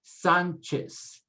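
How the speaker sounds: Sanchez as SAHN-ches, clear CH and final S; teacher modelling Sanchez in Spanish; Spanish pronunciation. Shown as a demonstration. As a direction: stress the first syllable of Sanchez, ending in an S sound. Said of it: "there's your che sound".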